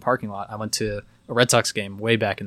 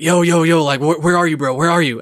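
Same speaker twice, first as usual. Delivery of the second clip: urgent phone answer; 6 semitones higher, 6 dB louder than usual. Retold or imitated. Drunk kid's friend answers my call, imitated urgent shouting. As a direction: imitate a loud, high, urgent bro.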